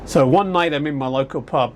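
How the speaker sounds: usual speaking voice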